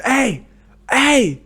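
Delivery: high, loud hail from another car; imitated yelling. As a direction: mimic a driver hollering for attention. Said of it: "like, yelling".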